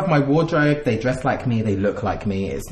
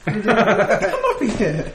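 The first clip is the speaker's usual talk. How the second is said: put-on slow, laughing Caribbean accent; 5 semitones higher, much slower than usual. Mocking a contestant in a slow Caribbean accent. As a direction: stretch it out in a mock Caribbean accent. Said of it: "by the way you were doing that accent".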